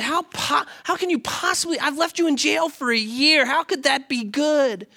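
the king can't believe it, pitch way up; incredulous king. Retold or imitated. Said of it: "the king looks at him and says".

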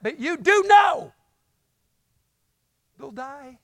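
preacher at full volume; full-force preaching. Acted. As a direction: build it up and press the point hard on the congregation.

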